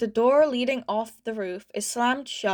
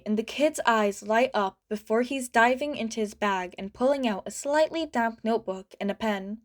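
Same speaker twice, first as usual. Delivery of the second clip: usual speaking voice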